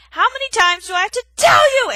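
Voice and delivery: very loud, high exasperated scream. Acted. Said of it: "Aerith screamed".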